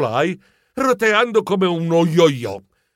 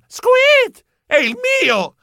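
much higher mouse squeak then exclamation; startled squeak; 4 dB louder, much slower than usual. Acted. Geronimo realising it's his phone, high squeak. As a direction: squeak in surprise.

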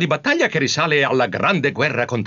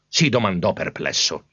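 ordinary delivery, nothing marked

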